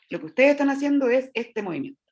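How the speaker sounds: ordinary talk, nothing marked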